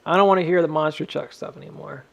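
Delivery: ordinary talk, nothing marked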